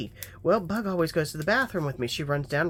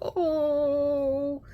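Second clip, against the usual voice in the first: melting aww; 11 semitones higher than usual. Retold or imitated. First holding the tiny kitten, tearful coo. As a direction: melt at the sight of it.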